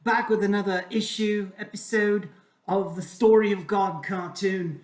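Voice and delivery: hammed-up British accent that's going to seed; shaky British accent. Host spoofs a YouTube sponsor read for Raid Shadow Legends, failing British accent. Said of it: "British accent is, uh, going to seed".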